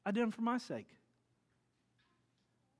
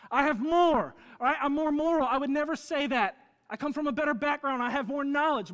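loud, high, swaggering brag; boasting one-upper; 9 semitones higher, 9 dB louder than usual. Retold or imitated. Preacher voicing a proud one-upper, loud bragging. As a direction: shout your superiority.

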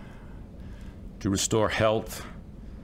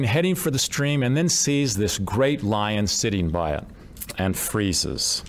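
usual speaking voice

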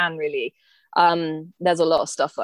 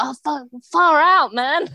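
high, slow Australian exclaim; hammed-up Australian surprise; Aussie exclamation; 8 semitones higher, 4 dB louder, slower than usual. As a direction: drawl it out like a true-blue Aussie. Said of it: "I'll be like".

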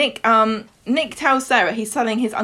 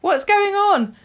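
panicked Sarah; 12 semitones higher, 4 dB louder, slower than usual. Retold or imitated. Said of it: "Sarah comes out and she's like".

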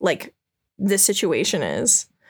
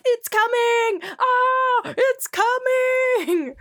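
high shouting; much slower than usual. Retold or imitated. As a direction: shriek it, dragging the last words. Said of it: "Lots of shouting happening".

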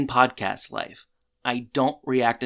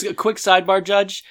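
raised, louder mock courtroom voice; cutting in on the judge with a sidebar, higher and louder; mock lawyerly objection; 7 semitones higher, 6 dB louder than usual. Friend playing lawyer to the judge, mock formal and raised. Mimicking Sean addressing the judge.

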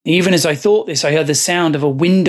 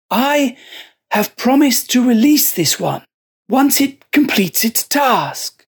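a possessed monk, higher and measured; fervent monk; 8 semitones higher than usual. Acted. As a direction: justify it with calm conviction. Joseph explains the flies.

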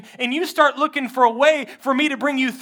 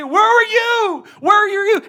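higher, louder pleading call; crying out; 8 semitones higher, 7 dB louder than usual. Acted. Believer crying out to God at the gate, louder higher pleading. Preacher acting a cry to God.

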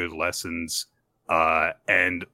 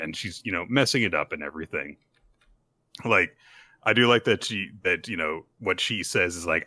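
ordinary delivery

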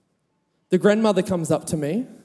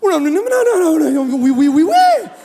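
much higher and louder, tumbling no and oui; overjoyed babble; 11 semitones higher, 8 dB louder than usual. Retold or imitated. French boy answering the youth leader, overjoyed and loud. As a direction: blurt it out, thrilled and flustered. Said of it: "this guy goes".

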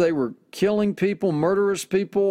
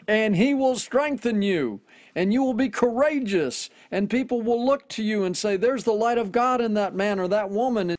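higher-pitched, fervent preaching build; impassioned preaching; 5 semitones higher than usual. Acted. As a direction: lift them up with rising fervor. Preacher builds to emotional peak.

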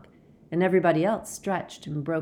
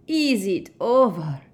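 loud, drawn-out relief; Fleur loud; 6 semitones higher, 5 dB louder, slower than usual. A narrator's reading. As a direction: call it out loud and slow across the room. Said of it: "said Fleur loudly".

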